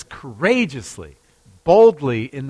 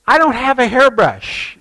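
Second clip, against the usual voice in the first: much higher and louder explanation; higher, louder excuse; raised voice; 11 semitones higher, 8 dB louder than usual. Retold or imitated. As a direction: call it out so he can hear. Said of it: "And she says".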